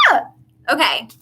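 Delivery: ordinary talk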